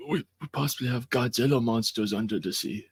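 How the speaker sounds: put-on fast, high, awestruck kid voice, laughing; childlike wonder. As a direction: gasp it out like a kid on Christmas morning. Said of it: "standard childlike wonder voice".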